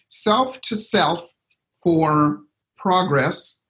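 slow, spaced recitation of the saying; reciting a saying. Shown as a demonstration. Coach recites an old saying slowly to the group. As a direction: lay each phrase down slowly, like a motto. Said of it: "what's that old saying".

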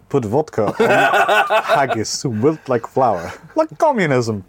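comically bad Scottish accent. Shown as a demonstration. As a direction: put on a hammed-up Scottish accent, breaking into laughter. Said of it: "you hear my Scottish accent?".

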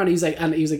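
usual speaking voice

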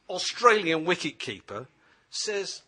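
ordinary talk, nothing marked

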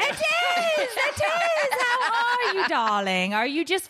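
hammed-up British accent. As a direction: gush like an over-the-top British socialite. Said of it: "do a British accent".

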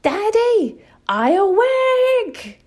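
put-on high, loud little child yelling for parents; kids yelling. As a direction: call out like a toddler stuck in a room. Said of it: "they yell".

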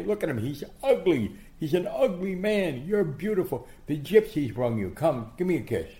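high, loud theatrical proclaiming; mock outrage. Boxer teasing little girl about her daddy, loud mock outrage. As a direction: declaim it teasingly, way up in pitch.

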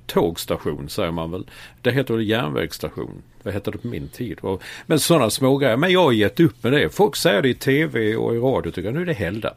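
ordinary talk, nothing marked

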